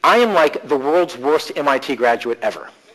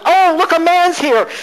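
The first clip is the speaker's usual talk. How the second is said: much higher, louder, a bit slower, delighted; delighted surprise; 13 semitones higher, 6 dB louder, slower than usual. Retold or imitated. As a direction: squeal it like spotting a rare find. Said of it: "She goes".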